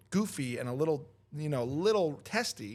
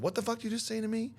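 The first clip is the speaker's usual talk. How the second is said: higher-pitched mock outrage; retold sudden anger; 7 semitones higher, faster than usual. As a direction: imitate a stranger flaring up. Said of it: "went from zero to a thousand".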